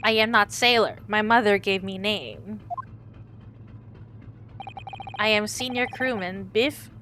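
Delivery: put-on loud, blunt Russian-accented crewman; proud Russian sailor introducing himself. Crewman Biff correcting the lawyers, loud Russian accent.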